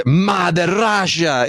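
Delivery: put-on slow, heavy Russian accent. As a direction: drag out Mother Russia in a mock Russian villain accent. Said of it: "Gary Oldman doing that Russian accent".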